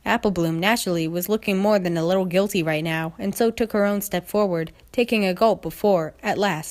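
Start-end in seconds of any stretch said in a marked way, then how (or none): none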